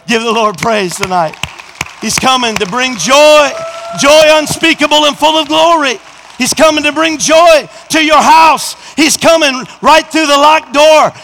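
loud, high, building preacher's cry; full-force preaching. Acted. Preacher lifting the church, loud and high. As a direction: preach it hard to the congregation.

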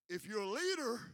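normal talking voice

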